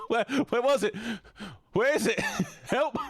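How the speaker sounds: high, giggling cries for help; panicked laughing. Real, not acted. Streamer lost after a scare in the game, laughing panic. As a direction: squeal the words through real laughter.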